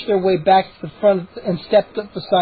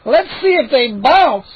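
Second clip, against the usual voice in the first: higher, louder, slow call; gnome calling out; 6 semitones higher, 4 dB louder than usual. Acted. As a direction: call out from the back. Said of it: "a gnome called from near the back".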